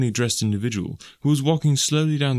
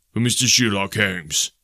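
gruff messenger. A narrator's reading. Messenger announces at door.

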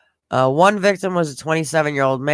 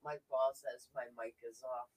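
muffled mic, far softer; 22 dB quieter than usual. Real, not acted. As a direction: voice dulled by a bad microphone. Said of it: "It sounds like it's muffled".

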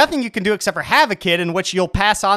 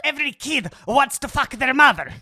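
high-pitched hammed-up German-accented voice; botched German accent, pitched way up; Freud; 9 semitones higher than usual. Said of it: "that's obviously Freud".

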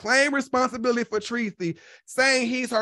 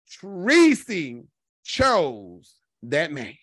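slow emphasis; 7 semitones lower, 3 dB louder, much slower than usual. Real, not acted.

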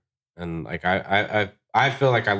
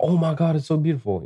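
mock gushing client; 9 semitones higher than usual.

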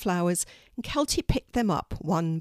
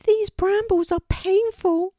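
very high, loud bird voice; pained little raven; 13 semitones higher, 5 dB louder than usual. Acted. Poppy tells Kelty the brambles hurt, high pained voice. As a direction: complain of pain in a high squeak.

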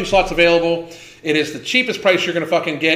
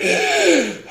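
mocking gasp for breath, pitched up; imitated gasp; 6 semitones higher than usual. Mocking a heavy woman's breathing, exaggerated gasp. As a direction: gulp air like she can't breathe. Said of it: "She's like".

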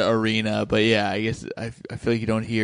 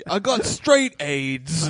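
slow, slightly higher put-on voice; mock film quote; 4 semitones higher, much slower than usual. Comic parodying the band's movie dialogue, slow and put-on. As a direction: draw it out as a hammed-up movie line.

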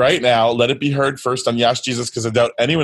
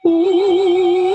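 high eerie toy-ghost wail; a long high wooo like a toy ghost; spooky ghost moan; 3 dB louder than usual. Retold or imitated. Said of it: "the generic spooky sound".

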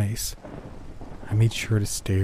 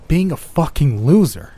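anguished outburst; 7 semitones higher, 7 dB louder than usual. Acted. Inner despair peaking.

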